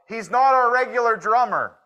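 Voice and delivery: high, loud mock yell from far off. A man in the canyon yelling to the cowboys, mock loud. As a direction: call it out across the canyon. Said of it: "you hear one of the Indians yell".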